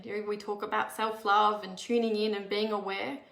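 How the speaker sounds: ordinary delivery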